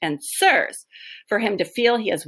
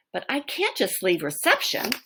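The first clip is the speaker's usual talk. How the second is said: normal talking voice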